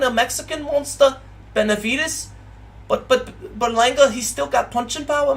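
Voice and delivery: a Liverpool fan ranting in a squeaky Scouse voice; Scouse accent. Pretend. Streamer as a Scouse fan warning viewers about Berlanga, in a high, hammed-up Liverpool accent. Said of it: "the Liverpool Scouse thing".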